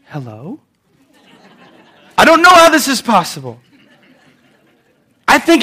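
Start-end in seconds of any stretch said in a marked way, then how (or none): 0.1-0.6 sudden soft, sweet phone voice; sweet phone hello. Retold or imitated. Mom answers the phone, suddenly sweet and soft. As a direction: switch to polite for the caller. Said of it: "answer the phone like this".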